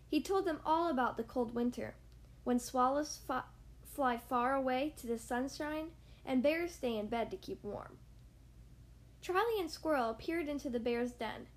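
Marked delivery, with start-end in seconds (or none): none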